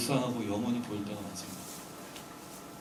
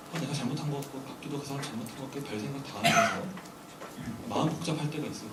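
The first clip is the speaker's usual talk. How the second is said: usual speaking voice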